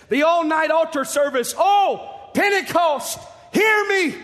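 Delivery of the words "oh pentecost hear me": crying out. Retold or imitated. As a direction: call out to the whole church, pleading. Preacher voicing the prophet's cry.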